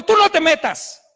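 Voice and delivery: acted-out heated command; sharp rebuke. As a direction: order him firmly to stay out. Pastor retells ordering stepfather off.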